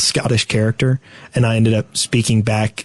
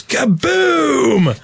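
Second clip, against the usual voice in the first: high Scottish shout of Kaboom; 12 semitones higher than usual. Retold or imitated. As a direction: bark it like the Demo Man. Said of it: "where he goes".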